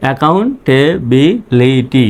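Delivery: slow, separated syllables in "accountability"; teacher's slow word breakdown. Shown as a demonstration. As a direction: sound out "accountability" for repeating along. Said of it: "আমার সঙ্গে সঙ্গে উচ্চারণ করবেন".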